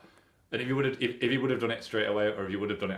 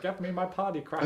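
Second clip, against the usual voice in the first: high put-on gangster voice; squeaky mock tough guy; high silly voice; 11 semitones higher than usual. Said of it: "he's like".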